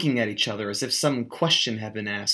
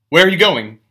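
louder, higher, sudden alarm; alarmed demand; 5 semitones higher, 9 dB louder than usual. A narrator's reading. Gatsby to narrator leaving, alarmed loud demand. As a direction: grab him back with the question. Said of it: "demanded Gatsby in immediate alarm".

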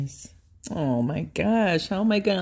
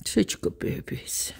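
hushed and softer, voice dropping; soft hushed praise; 3 semitones lower, 5 dB quieter than usual. Real, not acted. Owner hushing praise to her dogs, softer. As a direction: let it sink to a hush.

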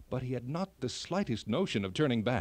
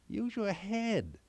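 a lightly voiced elf, higher and slow; elf's advice; 7 semitones higher, slower than usual. A narrator's reading. Narrator voicing the elf's riddle, slow and higher. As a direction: read the elf's line slowly, a little higher.

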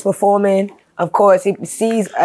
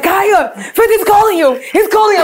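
much higher and louder, urgent call across a crowd; excited calling out; 13 semitones higher, 4 dB louder than usual. Retold or imitated. Homegirl urgently telling Kaya that Fifty is calling, high and loud. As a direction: shout her name like it can't wait.

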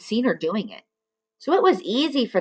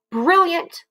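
high, delighted cry of brilliant; cried out; 10 semitones higher, 3 dB louder than usual. Acted. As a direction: Missus Twit grinning, loves the gun plan, crying out. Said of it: "cried Missus Twit".

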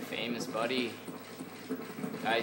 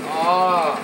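high, loud "oh"; loud outcry; 5 semitones higher, 11 dB louder than usual. Real, not acted. Gamer reacting to play, loud high outcry. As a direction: cry out loudly at the game.

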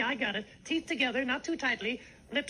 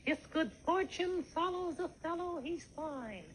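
fading out; 2 semitones higher, 6 dB quieter than usual. Real, not acted. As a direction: voice fading away to nothing. Record fading out.

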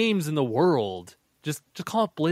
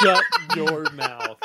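much higher, half-laughing squeal; mock scolding. Friend reacts to the in-birth bit, mock scolding.

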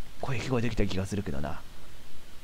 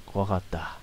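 relieved murmur; 3 semitones lower, 9 dB quieter, slower than usual. Real, not acted. Relief after the teacher leaves.

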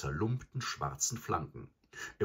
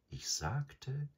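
purring; 9 semitones higher than usual. A narrator's reading. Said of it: "schnurrte der Panther".

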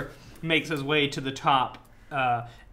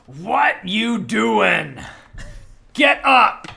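loud, slow, high barked command in broken Nodish; broken Nodish shout; 8 semitones higher, 10 dB louder, much slower than usual. Acted. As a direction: yell up the stairs in broken Nodish. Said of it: "broken Nodish come from".

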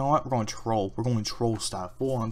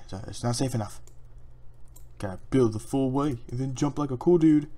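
normal talking voice

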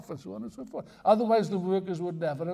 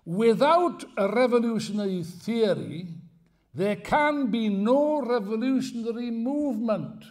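slow, louder declaiming of a quote; solemn quotation; 5 semitones higher, 6 dB louder, slower than usual. Acted. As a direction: read it out like a sacred text. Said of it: "Listen to this".

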